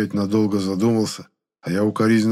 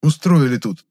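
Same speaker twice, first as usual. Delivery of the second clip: loud, higher, agitated reprimand; alarmed scolding, building louder; barked scolding; 7 semitones higher, 7 dB louder than usual. A narrator's reading. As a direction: tell them off urgently, voice raised. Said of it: "рявкнул я".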